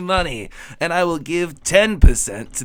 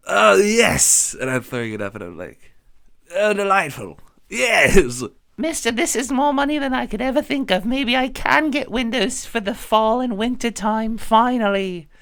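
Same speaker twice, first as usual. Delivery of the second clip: gleeful excitement; 5 semitones higher than usual. Acted. Gleeful over gold.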